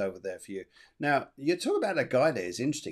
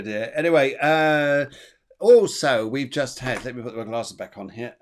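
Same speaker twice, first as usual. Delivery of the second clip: usual speaking voice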